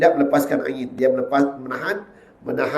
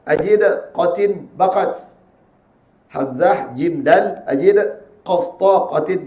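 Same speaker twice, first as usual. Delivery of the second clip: ordinary delivery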